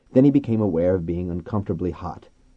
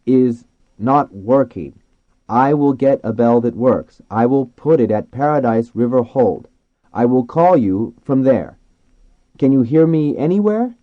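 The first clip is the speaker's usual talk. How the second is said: loud, slow, higher-pitched and deliberate; explaining to dolphins; 5 semitones higher, 9 dB louder than usual. A narrator's reading. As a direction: spell it out word by word for dolphins. Man explaining simply to dolphins.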